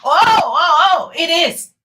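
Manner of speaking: hammed-up loud insistence; emphatic contradiction. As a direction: cut in with booming exclamations. Bursts in to contradict the mimicry.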